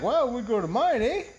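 high, cheeky Canadian reply; lightly put-on Canadian pick-up voice; Canadian local. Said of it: "He's like".

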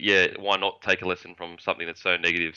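usual speaking voice

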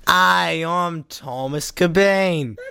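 high, slow attempt at a Boston accent; badly faked Boston accent, drawn out. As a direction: try your best Boston accent. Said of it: "do your best Boston accent".

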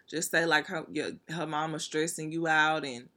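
normal talking voice

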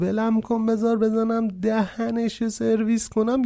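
mildly acted protest at being held back; straining to fight. A narrator's reading. Held back mid-fight, narrated threat.

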